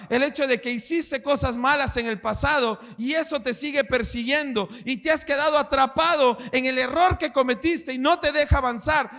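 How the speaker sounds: ordinary delivery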